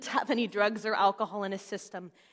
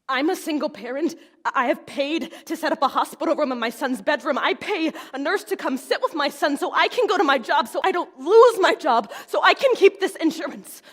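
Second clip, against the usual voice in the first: building from firm to loud, high outburst; exasperated protest; 5 semitones higher, 9 dB louder than usual. Retold or imitated. Single mother telling off a lawyer who wants a retainer, exasperated protest. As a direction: pile it up, louder and higher to the end.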